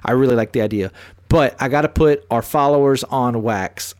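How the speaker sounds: normal talking voice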